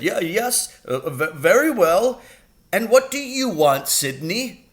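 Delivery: high, stumbling, nervous headmaster; flustered stammer. Acted. Headmaster hiding the gin bottle from two boys, stammering high. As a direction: stammer to cover being caught. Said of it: "He jumped a mile when he saw us".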